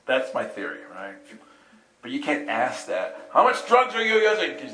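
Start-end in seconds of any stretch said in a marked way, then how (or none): 3.3-4.6 blunt question. Pretend. Blunt question he shouldn't ask.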